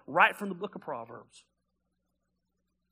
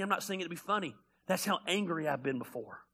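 usual speaking voice

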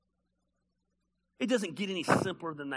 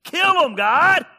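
mad at God; 11 dB louder, much slower than usual. Acted. David asking God to kill his enemies, rising to an angry cry. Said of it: "David was so mad".

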